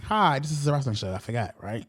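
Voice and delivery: normal talking voice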